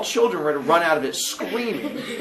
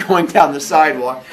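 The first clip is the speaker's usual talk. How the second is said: ordinary delivery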